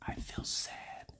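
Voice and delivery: slow, barely audible whisper; faint inner voice; so quiet. Acted. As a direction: whisper it like a tiny knock. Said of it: "because it's so quiet".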